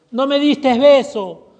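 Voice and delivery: slow, loud, high stress on "diste"; emphatic rebuke. Acted. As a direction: lean hard on "No me diste beso". Climax of the scripture reading. (reproche enfático, lento y fuerte)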